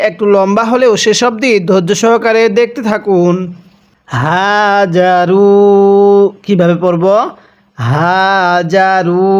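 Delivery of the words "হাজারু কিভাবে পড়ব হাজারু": হাজারু with a throaty হা, very slow. Shown as a demonstration. Teacher demonstrates the throat sound in হাজারু. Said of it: "গলার মধ্যে একটু আওয়াজ করে পড়ব".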